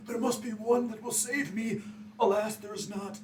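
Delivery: high-pitched theatrical lament; hammed-up desperate plea. Acting out fumbling for holy symbols while drowning.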